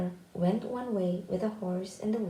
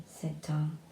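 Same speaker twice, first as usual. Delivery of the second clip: usual speaking voice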